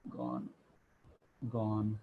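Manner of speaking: low, hushed mock farewell; a soft, drawn-low send-off for the departed; mock solemn. Teacher erasing numbers as if they die, mock solemn.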